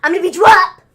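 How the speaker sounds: loud retold taunt, dropping on the name; hammed-up wrestler yell; mock threat. Podcaster retelling Murphy beating up Dominic, loud mock threat.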